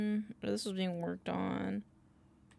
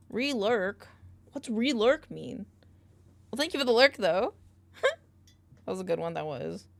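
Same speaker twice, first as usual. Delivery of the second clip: normal talking voice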